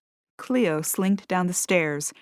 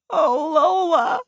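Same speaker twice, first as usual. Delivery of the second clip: crying; 11 semitones higher, 5 dB louder, slower than usual. A narrator's reading. Cat breaks down again.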